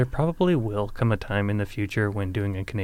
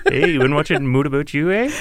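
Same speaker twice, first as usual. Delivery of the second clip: put-on a light joking Canadian accent with a teasing eh; mock Canadian accent; 3 semitones higher, 6 dB louder than usual. Teasing cohosts about a Canadian show title, mock Canadian accent. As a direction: tease like a Canadian on the rise of eh. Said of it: "with the Canadian accent".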